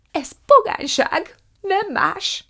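high, loud horrified wail. A narrator's reading. Prue laments over Margó's cabbage face, wailing. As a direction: lament it at full pitch. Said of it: "jajongott Prue".

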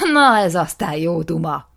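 loud, furious exclamation; shouting out. Acted. Nisim crying out at his brother's excuse. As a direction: cry it out in outrage. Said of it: "kiáltott fel Nisim".

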